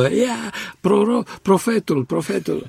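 high mock cheer, easing off; imitated student cheering. Interpreter relaying students cheering on their classmate, playful mock cheer. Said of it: "studenții cumva făceau galerie susținându-mă".

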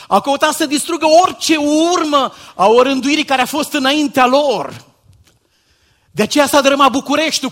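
loud, high pulpit shout; shouting sermon. Acted. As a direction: drive the warning home with full force. Preaching at full force.

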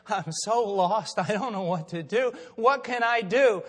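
imitating a desperate woman pleading for help; retold despair. Acts out the despairing lost woman.

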